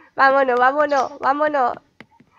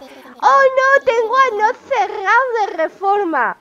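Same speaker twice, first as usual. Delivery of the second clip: higher, louder hammed-up "oh no"; mock dismay; 8 semitones higher, 7 dB louder than usual. Fauno finds the shop closed for renovation, hammed-up dismay. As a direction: play up the deer's alarm.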